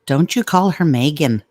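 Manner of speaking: Irish accent, with Megan as "MAY-gan"; mock-scold in Irish lilt, Megan said "MAY-gan". Shown as a demonstration. Correcting the name Megan, "MAY-gan" as the wrong one, in an Irish accent. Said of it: "have to say with the Irish accent".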